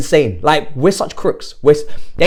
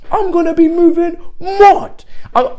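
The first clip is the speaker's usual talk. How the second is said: much higher, louder yelled repeat; real excited shout; 13 semitones higher, 5 dB louder than usual. Real, not acted. Fan yelling about the new signing, thrilled. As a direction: scream it out with joy.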